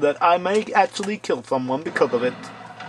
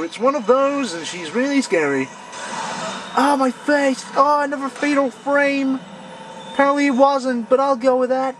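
high, fast frightened chatter; excited fright; 8 semitones higher than usual. Real, not acted. Gamer fighting a scary ghost, excited. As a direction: keep the pitch up in panic.

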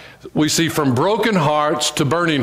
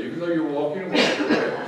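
laughing; 3 semitones higher, 6 dB quieter, faster than usual. Shown as a demonstration. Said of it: "touch your neighbor and say".

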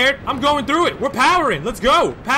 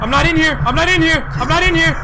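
louder, higher frantic repeats; frantic pleading; 5 semitones higher, 4 dB louder than usual. Real, not acted. Gamer hiding from the monster, frantic. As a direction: beg it over and over.

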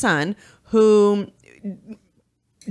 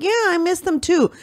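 imitating a mother's shrugging, casual reply, higher; real nonchalant; 11 semitones higher than usual. Retelling a mother in jail talking to her son, mock nonchalant. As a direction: toss it off like it means nothing. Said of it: "Like, real nonchalant".

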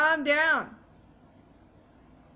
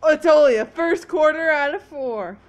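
loud realization; 7 dB louder than usual. Real, not acted. Realizes how little game has passed.

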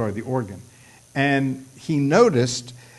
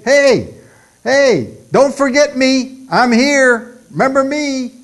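loud, high, insistent calls to be noticed; calling for attention; 12 semitones higher, 9 dB louder than usual. Acted. Servant calling out to the Lord not to forget him, high insistent shout. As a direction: hail someone urgently, high and loud.

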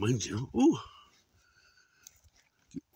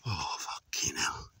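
whispering; 6 semitones lower, 3 dB quieter, slower than usual. Real, not acted. Awed hushed swear at view.

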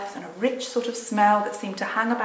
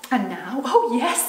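put-on slow, loud excited girl; bursting with delight; 4 semitones higher, 7 dB louder than usual. A narrator's reading. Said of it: "jumping up and clapping her hands".

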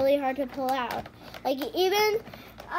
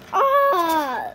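frustrated cry; 3 semitones higher, 6 dB louder than usual. Real, not acted. Frustrated toys too hard.

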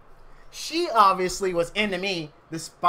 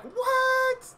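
a much higher startled what; shocked mom. Retold or imitated. Mom hearing he will marry the girl, shocked and high. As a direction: mom can't believe her son wants to marry. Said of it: "my mom was so shocked".

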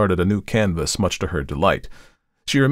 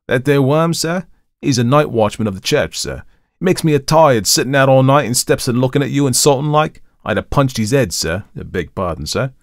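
indignant Cockney servant, pitched up; Thomas; 7 semitones higher, 5 dB louder than usual. A narrator's reading. Thomas complains to his employer about the watchman, in Cockney. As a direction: grumble in Cockney, then apologise. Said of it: "had not only modified Thomas's Cockney dialect".

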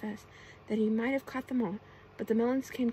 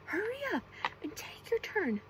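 high-pitched mock yell from a stamping imp; impatient shout; 10 semitones higher than usual. A narrator's reading. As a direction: shout it high, without real volume. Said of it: "stamped his little pointed feet and shouted".

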